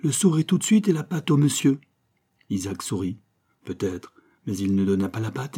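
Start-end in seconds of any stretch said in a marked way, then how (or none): 0.0-1.8 commanding. Acted. Toto commanding the dog.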